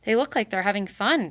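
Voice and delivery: usual speaking voice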